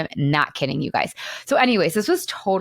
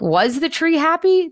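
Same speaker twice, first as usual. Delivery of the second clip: incredulous question; 10 semitones higher than usual. Retold or imitated. Host doubting the book's happy ending, incredulous and high. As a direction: ask it like you can't believe it. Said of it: "I'm like".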